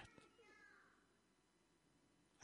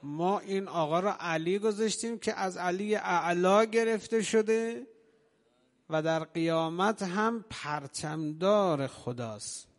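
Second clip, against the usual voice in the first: loud, higher, slowing pulpit cry; preaching at full force; 4 semitones higher, 5 dB louder, slower than usual. Acted. Preacher proclaims Ali's name to the mourners, full-force preaching.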